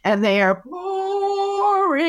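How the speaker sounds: slow, high, sing-song droning of a dull speaker; bored droning. Shown as a demonstration. Mocking a dull speaker to the audience, slow sing-song drone. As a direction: drag the words out like a bored presenter. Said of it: "speakers who have absolutely no enthusiasm".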